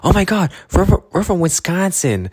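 imitating an excited tourist; excited gasp. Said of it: "I'm from Canada".

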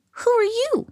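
high-pitched timid question; small, wary, high voice; a little frightened. A narrator's reading. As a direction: ask it high and nervously. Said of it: "a little frightened".

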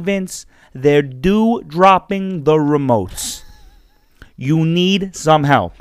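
slow, drawn-out earnest lecture; Vince Russo. Acted. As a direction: lecture the boss word by word. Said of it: "Vince Russo".

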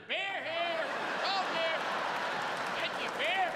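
high stadium vendor call; hawking beer to dad's buddies. Retold or imitated. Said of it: "like a vendor".

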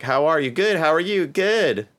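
bright fake-polite back-and-forth; mock small talk. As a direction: parody a canned greeting exchange. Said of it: "the American thing".

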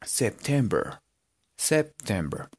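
September softly, in posh English style; posh "gomelo" pronunciation. Shown as a demonstration. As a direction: repeat September softly with preppy English sounds. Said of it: "un poquito más gomelo". (September suave y gomelo)

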